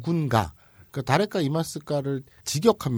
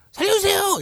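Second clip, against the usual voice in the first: very high-pitched, louder scream; urgent cry for help; 5 dB louder than usual. Acted. Person in danger with no time to spare, acted scream. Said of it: "이러기도 바쁜데".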